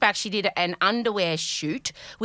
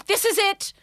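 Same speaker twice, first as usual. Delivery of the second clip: high-pitched mock farewell announcement; dramatic farewell; 9 semitones higher, 3 dB louder, much slower than usual. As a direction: announce a grand exit, pitched way up.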